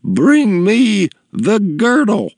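high, slow commanding matron; demanding woman, high and drawn out; imperious customer. A narrator's reading. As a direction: draw out each word as a haughty order. Imperious customer orders a girl.

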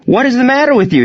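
high-pitched, exasperated challenge; angry demand. A narrator's reading. Batter turns on the laughing catcher, angry demand. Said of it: "he demanded".

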